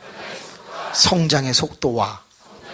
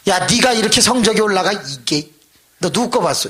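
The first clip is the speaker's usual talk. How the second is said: accusing teacher; 6 semitones higher, 5 dB louder than usual. Retold or imitated.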